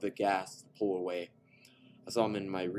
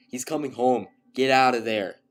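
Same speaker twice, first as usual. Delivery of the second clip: higher and much louder, panicked; frantic warning; 6 semitones higher, 15 dB louder than usual. A narrator's reading. Texted warning to Beth to flee, panicked shout. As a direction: scream the warning to get her out.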